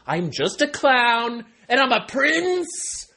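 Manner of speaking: high, loud, drawn-out declaration; big mock-proud shout; comic proclamation. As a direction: proclaim it slowly at the top of your voice. Comic self-declaring outburst.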